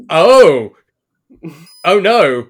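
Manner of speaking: loud, high burst of excitement; excited gasp. Acted. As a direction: cry out in sudden delight. Excited realization in character.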